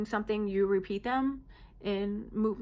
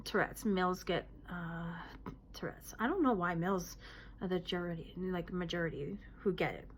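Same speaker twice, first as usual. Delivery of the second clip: ordinary talk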